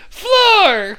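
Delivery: high loud bark of one word; shouted word. Retold or imitated. Father Jack naming the floor, barking loudly. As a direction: yell it like a confused old man.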